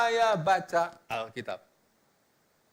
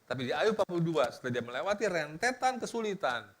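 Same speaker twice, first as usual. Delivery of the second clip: ordinary talk, nothing marked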